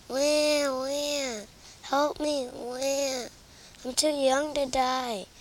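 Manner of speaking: high mock baby wail with wah wah. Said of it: "[name] is wailing like a baby".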